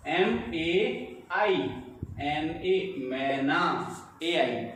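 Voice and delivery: ordinary talk, nothing marked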